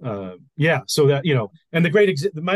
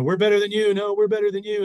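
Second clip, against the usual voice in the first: higher, louder taunting back and forth; half-shouted imitation of soldiers' taunts; mock yelled taunts; 7 semitones higher, 5 dB louder than usual. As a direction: trade boasts like rival armies yelling. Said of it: "they'd yell at each other".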